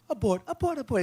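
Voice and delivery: mock sweet, high and light sing-song; lightly and kindly. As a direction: toss the word off as if harmless. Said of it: "They say it so lightly and kind".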